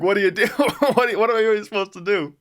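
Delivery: high, loud, incredulous reaction; retold bewilderment. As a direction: blurt it out as a baffled bystander, half laughing. Retold bewildered reaction to screaming friend.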